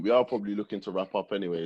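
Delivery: ordinary talk, nothing marked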